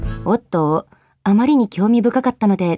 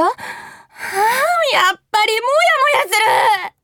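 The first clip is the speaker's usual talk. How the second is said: drawn-out, high wail of frustration; frustrated outburst; 8 semitones higher, much slower than usual. Acted. Woman left alone after the quarrel, exasperated wail. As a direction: groan it out long and high.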